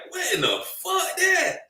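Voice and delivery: high-pitched mock outrage at dad; kids yelling at dad. The hero's kids confronting their dead dad, high mock outrage. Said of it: "I know his kids like".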